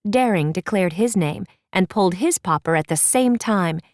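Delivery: ordinary delivery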